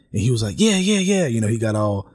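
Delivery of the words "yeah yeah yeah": imitating an amped-up man, high quick yeses; eager, animated retold agreement, pitched way up; 興奮した口調の真似. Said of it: "amped up and animated".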